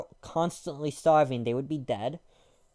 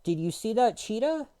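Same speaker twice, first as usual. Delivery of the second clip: higher mock question; mocking dumb voice; 7 semitones higher than usual. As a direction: keep playing the clueless guy. Mocking people who call cats cheetahs.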